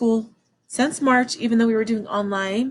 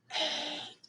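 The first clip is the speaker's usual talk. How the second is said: breathy whispered number; 4 semitones higher, 15 dB quieter than usual. Shown as a demonstration. Teacher whispering every other number in a counting drill, whispered. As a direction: whisper it, no voice at all. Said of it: "Now we're gonna whisper every number".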